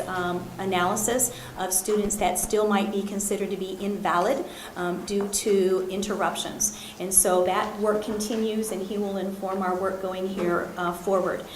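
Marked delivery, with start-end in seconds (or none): none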